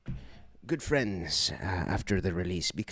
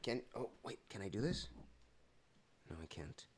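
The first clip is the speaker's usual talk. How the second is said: ordinary talk, nothing marked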